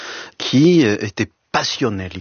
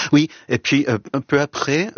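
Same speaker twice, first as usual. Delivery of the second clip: ordinary talk, nothing marked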